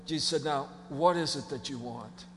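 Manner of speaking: soft, low, gentle invitation; a hint of tender kindness; Jesus quietly calling the blind man over. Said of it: "he said".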